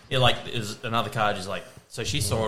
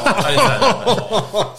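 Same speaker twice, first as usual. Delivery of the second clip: slow, high, laughing through the words; laughing denial; 11 semitones higher, 14 dB louder, much slower than usual. Real, not acted. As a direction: laugh it off, protesting. Laughs denying her own quote.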